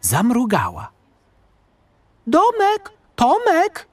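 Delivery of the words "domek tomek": high-pitched mother's cry; cross mother yelling names; shouting. A narrator's reading. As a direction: call the children sharply. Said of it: "krzyknęła".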